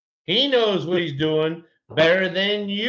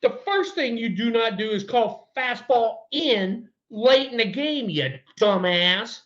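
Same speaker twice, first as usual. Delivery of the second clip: high, heated retold scolding; retold angry lecture; 8 semitones higher than usual. Player retelling his rant at the rookie pitcher, heated and high. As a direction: hammer the pitcher high and hot. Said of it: "I said".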